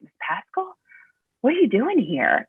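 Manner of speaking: a hint of a surprised kid; lightly voiced surprised boy. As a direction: imitate a child puzzled to see the teacher. Voicing the surprised boy at home.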